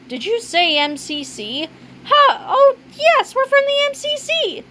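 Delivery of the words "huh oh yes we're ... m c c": very high, eager agreement; eager bluffing. Acted. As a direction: jump on the excuse, pitched way up. Seizing on a cover story.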